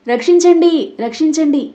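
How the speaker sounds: high, loud staged screams; feigned panic, yelling to draw neighbours; loud cry for help. Acted. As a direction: scream for rescue, putting on a show. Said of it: "అని గట్టిగా అరుస్తుంటే".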